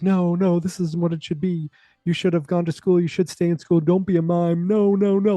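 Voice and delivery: high-pitched, pleading objection; imitating an appalled father; mock distressed protest. Acted father's protest at mime.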